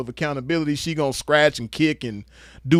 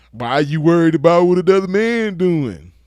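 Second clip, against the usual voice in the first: louder, stretched-out mock protest; mocking complainer; 3 semitones higher, 6 dB louder than usual. Roaster mimics men who object to his comments, loud mockery. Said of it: "that's how them bitches talk".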